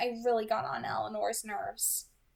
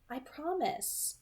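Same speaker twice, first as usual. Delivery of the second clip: tender promise; 7 semitones higher, 4 dB quieter, much slower than usual. A narrator's reading.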